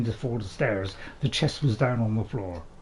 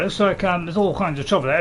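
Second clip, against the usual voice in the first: usual speaking voice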